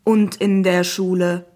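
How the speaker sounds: loud, slow, monotone computer question; talking app. Acted. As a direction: probe evenly, like a machine. Zeus app questions the boy. (langsame, monotone Computerstimme)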